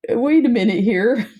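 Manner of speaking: playful mock offense; mock protest. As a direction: pretend to be caught off guard. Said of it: "I'm like".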